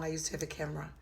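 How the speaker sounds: ordinary talk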